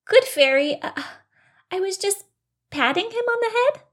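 high, hesitant little bunny; excuse-making bunny. Acted. Bunny caught by fairy, high excusing voice. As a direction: fib upward in a high voice.

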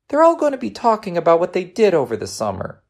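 put-on high-pitched child voice, worried; small boy airing his dread; downcast young boy. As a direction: complain like an anxious schoolboy.

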